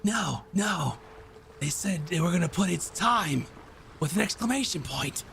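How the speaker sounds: put-on voice, insistent and high; Chowder. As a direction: object as a squabbling goblin-crew character. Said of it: "Chowder goes".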